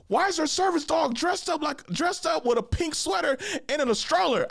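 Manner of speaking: high, sing-song mock complaint; mocking the dude. Imitating the man complaining about the dressed-up dog, high mock whine.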